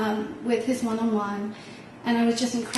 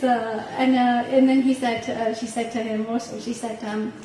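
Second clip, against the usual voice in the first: ordinary talk, nothing marked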